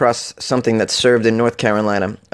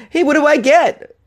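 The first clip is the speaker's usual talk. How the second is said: very high, excited comic question; hammed-up eagerness; mock eager demand; 14 semitones higher than usual. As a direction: pipe up as an over-keen contestant. Acts eager raffle entrant.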